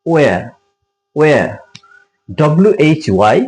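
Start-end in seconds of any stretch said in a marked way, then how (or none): 0.1-1.5 where as "weh-a", starting on plain w; silent h. Shown as a demonstration. Teacher repeats where for viewers, h and r dropped. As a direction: say where twice, separately, no final r. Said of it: "এইচ pronounce হবে না".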